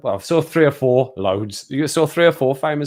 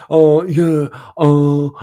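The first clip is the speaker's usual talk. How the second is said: loud, higher mock Edinburgh exclamations; mock Edinburgh accent; 5 semitones higher, 9 dB louder, much slower than usual. Imitating what locals all say, loud Edinburgh accent. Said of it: "that's all they say".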